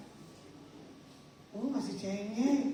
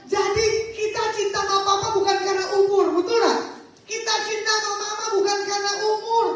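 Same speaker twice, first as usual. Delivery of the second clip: loud, high preaching declaration; raised voice driving home the point; emphatic exhortation; 6 semitones higher, 7 dB louder than usual. Real, not acted. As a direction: proclaim it forcefully to the whole room. Preacher drives home his main point.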